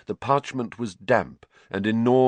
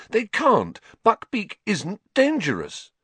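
put-on a boy refusing to accept it; 13 semitones higher than usual. Harry protesting.

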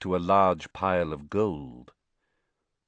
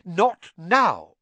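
firm; 10 semitones higher, 7 dB louder than usual. A narrator's reading. Mrs Weasley cutting him off.

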